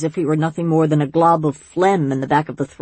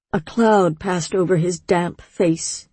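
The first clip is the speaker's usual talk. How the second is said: usual speaking voice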